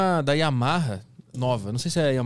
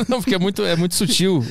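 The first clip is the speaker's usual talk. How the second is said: laughing while talking, higher; 5 semitones higher, 4 dB louder than usual. Real, not acted. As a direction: talk through a real laugh. Laughs while answering teasing.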